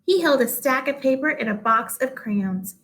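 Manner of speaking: plain reading voice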